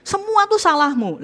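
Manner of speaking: high, loud blaming jab; mock accusing outburst. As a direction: throw the blame at them. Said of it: "Hindari juga".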